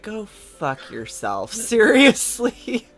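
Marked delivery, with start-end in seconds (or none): 0.0-1.5 deadpan retort. Retold or imitated. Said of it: "I was like".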